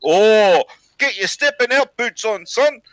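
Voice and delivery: excited high-pitched mock Maori accent; Maori chief. Chief announcing news to his son, excited Maori accent. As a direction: exclaim the punchline in a high Maori voice.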